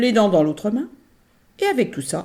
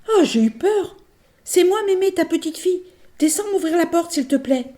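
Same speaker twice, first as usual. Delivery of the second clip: put-on much higher child's voice; little girl; 12 semitones higher, 3 dB louder than usual. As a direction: ask at the door. La fillette à la porte. (voix de fillette)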